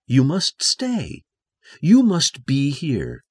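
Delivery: slow, emphatic command; firm and urgent, leaning on each phrase; insistent. Acted. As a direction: insist on it, drawing out the words. Rochester insisting that Jane stay.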